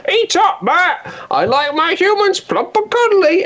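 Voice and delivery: very high put-on statue voice; statue's voice. Lovestruck man voicing the statue to himself, very high voice. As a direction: squeak it out like a cute giant. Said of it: "start doing your voice".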